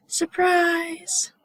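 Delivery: gasp, then high, stretched party cry; pretend surprise. Teacher acts out a birthday cake surprise, pretend delight. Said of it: "So that's surprised".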